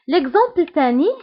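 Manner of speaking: ordinary delivery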